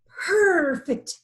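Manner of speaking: drawn-out satisfied purr; lion's purr. A narrator's reading. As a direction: savour the word like a contented cat. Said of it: "he purred".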